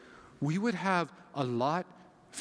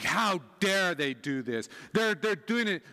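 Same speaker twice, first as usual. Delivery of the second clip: mock outrage, rising higher and louder; angry listener; 4 semitones higher, 4 dB louder than usual. Paul's friend reacting to the letter about rivals, angry. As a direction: play an indignant follower of Paul. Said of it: "like, really angry".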